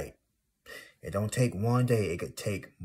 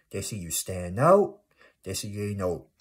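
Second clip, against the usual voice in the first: normal talking voice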